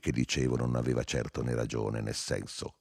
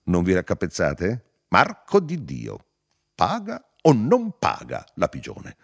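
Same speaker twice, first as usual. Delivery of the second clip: high, loud, punched-out questions; insistent questioning; 6 semitones higher, 5 dB louder than usual. A narrator's reading. Narrator presses the baffled pair, insistent. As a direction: spell it out impatiently.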